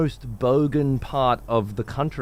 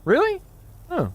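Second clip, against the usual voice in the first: incredulous. Real, not acted. Incredulous at chat's claim.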